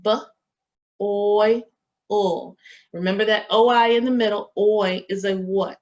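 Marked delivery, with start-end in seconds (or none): 0.0-2.6 boil split into B, oy, ul, each sound separate; sounding out phonemes. Shown as a demonstration. Teacher segmenting boil into phonemes, sound by sound. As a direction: pull boil apart sound by sound. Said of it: "What about boil".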